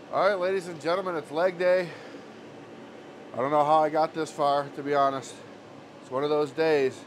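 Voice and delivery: loud, high, pumped-up intro; excited announcer. Real, not acted. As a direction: hype up the viewers. Hyped greeting to camera viewers.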